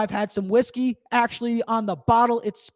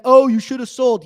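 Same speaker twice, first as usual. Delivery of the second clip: louder sing-song jeer; mocking taunt; 3 semitones higher, 8 dB louder than usual. Mocking taunt threads aimed at holders, louder and jeering. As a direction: taunt like a smug forum poster.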